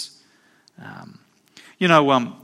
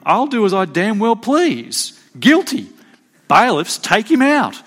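higher, louder commanding verdict; stern judge; 7 semitones higher, 5 dB louder than usual. Retold or imitated. Preacher imitating Judge Judy sentencing the young man, loud and commanding. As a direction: bang down the ruling like a TV judge.

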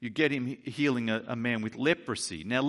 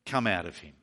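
low, restrained, firm order; stern command; 5 semitones lower than usual. Retold or imitated. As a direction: give a controlled order without raising the voice. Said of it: "Jesus says sternly".

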